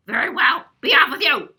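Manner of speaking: troll roar. Acted. Troll voiced in story.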